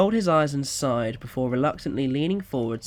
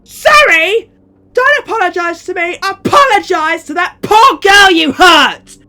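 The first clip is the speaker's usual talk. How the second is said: much higher and louder, shouting him down; angry mother yelling; 18 dB louder than usual. Acted. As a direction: rage at the child's excuse. Mother yelling at crying son.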